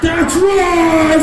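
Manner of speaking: high, very loud gloating yell; triumphant shout. Pretend. Giant comic answering the villagers, loud gloat. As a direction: roar it in triumph.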